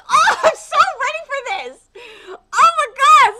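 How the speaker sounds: loud, high excited squeal; thrilled anticipation. Real, not acted. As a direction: shout it, bursting with eagerness. Excited for next chapter.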